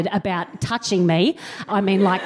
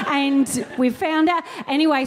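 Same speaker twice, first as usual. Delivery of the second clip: ordinary talk, nothing marked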